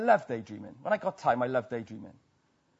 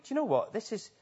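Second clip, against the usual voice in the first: casual inner voice; 6 semitones higher, 3 dB quieter than usual. Retold or imitated. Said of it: "and we start to think".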